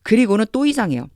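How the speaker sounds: normal talking voice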